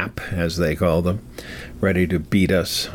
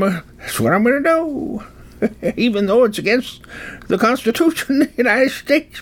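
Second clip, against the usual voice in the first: high, hammed-up rustic codger; folksy old-timer; 11 semitones higher than usual. Old-timer quoting Sam Snead on free gifts, folksy and high. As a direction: crow it like a stubborn backwoods old-timer.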